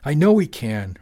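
loud, high exasperated retort; shot back. A narrator's reading. Father shooting back at wife, exasperated. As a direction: hit KNOW hard, impatiently. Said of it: "Richard shot back".

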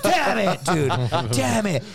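high-pitched, exasperated cursing; mock frustration. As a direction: curse your luck in comic dismay. Retelling his frustrated reply to friend.

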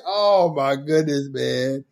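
usual speaking voice

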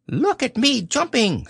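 put-on high, small squeaky child's voice; squeaky Roo. A narrator's reading. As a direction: squeak it out like an excited little joey. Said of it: "squeaked Roo".

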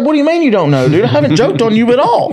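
indignant protest. Retold or imitated. Protesting the teacher's accusation, retold.

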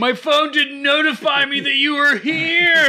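high, drawn-out mock protest; hammed-up excuse to the driver; mock complaint. Joking imitation of the beer-seeking husband.

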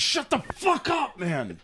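high, drawn-out mock scolding; retold shushing. Retells telling him off in line.

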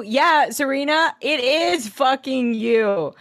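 high, slowing sarcastic accusation; acted indignation. Nate throwing Serena's excuse back, high indignant retort. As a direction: stretch the last words out, high and pointed.

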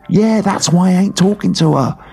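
slipping into pirate-like Cockney. Real, not acted. Co-host defending his silence towards Mandy, pirate Cockney. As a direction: protest in a piratey Cockney accent. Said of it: "I'm talking like a pirate cockney again".

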